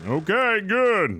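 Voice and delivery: high-pitched in-character okay. Acted. In-game character reply.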